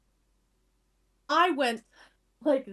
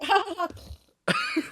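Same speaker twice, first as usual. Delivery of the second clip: imitated drowsy ha ha ha rising much higher at the end; a half-asleep stranger's brief laugh, mocked; quoting the sleeping seatmate, mock laugh climbing high; sleepy laugh; 8 semitones higher, 4 dB quieter, slower than usual. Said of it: "He was just like".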